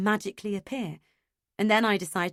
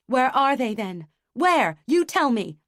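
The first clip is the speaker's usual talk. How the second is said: loud, high angry shout; 4 semitones higher, 7 dB louder than usual. A narrator's reading. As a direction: yell it in desperation. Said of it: "I shout".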